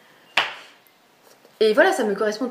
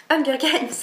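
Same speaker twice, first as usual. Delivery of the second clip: higher, punchy reveal of the answer; triumphant reveal; 5 semitones higher than usual. Pretend. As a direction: announce it like a prize. Big reveal after drumroll.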